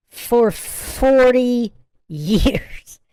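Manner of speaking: forty drawn out very slowly; slow emphatic forty; stress on forty. Shown as a demonstration. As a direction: hammer forty home slowly.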